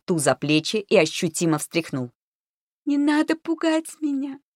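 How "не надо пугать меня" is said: very high, sustained frightened wail; high, frantic chant; hysterical plea. A narrator's reading. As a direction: repeat it in panic, pitched right up. Hysterical girl repeats her plea.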